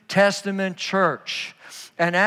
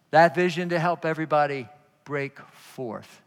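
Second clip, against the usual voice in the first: soft preaching; 4 semitones lower, 3 dB quieter than usual. Acted. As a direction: draw them in with a low hush. Quiet intimate close of sermon.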